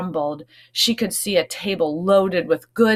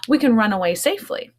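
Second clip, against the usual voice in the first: ordinary delivery, nothing marked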